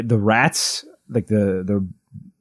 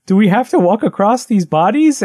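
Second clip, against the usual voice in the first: put-on high, louder, pleading little-boy voice; little brother voice; 11 semitones higher, 4 dB louder than usual. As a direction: whine it like a scared seven-year-old. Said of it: "he's, like, seven, and he's like".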